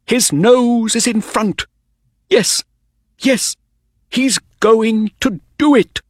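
high, breaking into thrilled cries; mounting racetrack excitement; excited cheering. A narrator's reading. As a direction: cheer the horse to the line, pitched high. Owner cheering the race finish.

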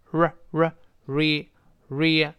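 r isolated, then drawn into re, "ree"; curled-tongue r. Shown as a demonstration. Teacher breaks down the sound for learners, r then re, tongue curled. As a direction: curl the tongue back for r, then add re slowly. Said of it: "字母R要舌尖往回勾".

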